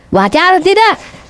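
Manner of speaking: high, drawn-out challenge to the crowd; rallying call. Real, not acted. (seruan tinggi memancing jawaban jemaah)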